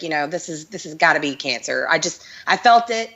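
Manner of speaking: ordinary talk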